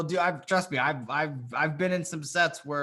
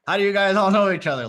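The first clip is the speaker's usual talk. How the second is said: louder, higher, quick-fire question; assertive group question; 4 semitones higher, 7 dB louder, faster than usual. Shown as a demonstration. Coach demonstrating the question for the group, assertive. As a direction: fire it out briskly and loudly.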